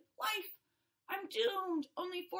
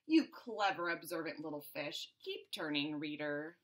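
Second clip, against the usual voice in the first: much lower, smug self-praise; smug aside; 12 semitones lower than usual. Acted. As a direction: purr it to the reader. Fish praises itself, addresses reader.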